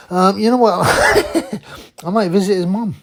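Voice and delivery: laughing. Real, not acted. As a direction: cackle through a cheeky jab. Mocking joke about rival's mum.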